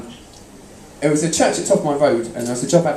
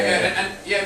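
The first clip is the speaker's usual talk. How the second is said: normal talking voice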